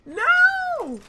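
very high, loud cry of no; anguished scream. Real, not acted. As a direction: scream as you get killed. Getting killed in game.

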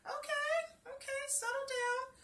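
plain reading voice

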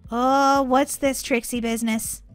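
ordinary talk, nothing marked

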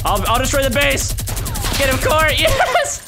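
high, loud triumphant yelling; excited battle shouting. Real, not acted. Gamer urging Court on in attack, excited shouting. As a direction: yell to rally your teammate.

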